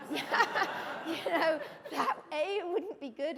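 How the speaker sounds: amused, laughing as the words come out. Real, not acted. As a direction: talk through a burst of laughter. Laughs at own joke about accent.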